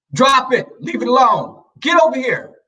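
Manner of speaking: high, rapid-fire orders in bursts; bad cop command. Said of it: "I had to be the bad cop".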